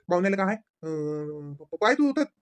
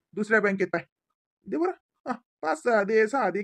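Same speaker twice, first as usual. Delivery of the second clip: normal talking voice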